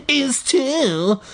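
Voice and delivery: put-on high, childish squeaky comeback; bratty arguing. A narrator's reading. Kid arguing with his brother, high bratty retort. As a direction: squeal it back like a squabbling kid. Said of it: "I argued like a bitch".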